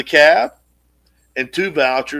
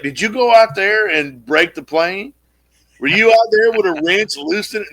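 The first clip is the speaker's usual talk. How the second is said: sweet mock question; 6 semitones higher, 3 dB louder than usual. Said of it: "laid it on thick as honey".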